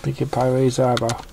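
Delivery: ordinary delivery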